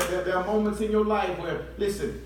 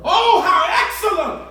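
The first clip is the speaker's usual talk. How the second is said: much higher and louder shout; shouted praise; 9 semitones higher, 10 dB louder than usual. Real, not acted. Preacher shouting praise to the congregation. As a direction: burst out with it.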